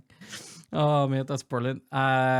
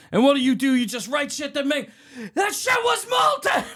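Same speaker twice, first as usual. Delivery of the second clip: high loud rant peaking in a scream; hammed-up enraged screaming; acted furious rant; 11 semitones higher, 3 dB louder than usual. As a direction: build to a very high, stretched yell. Acts out angry monologue.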